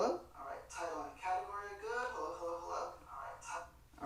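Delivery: quiet, fast unhinged villain voice; cackling madman tone, hushed; crazy voice. Shown as a demonstration. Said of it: "That's my crazy voice".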